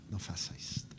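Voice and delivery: whispered "não faça isto", no voice. Retold or imitated. Kneeling speaker whispering back to the weeping man. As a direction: breathe the plea in a hush.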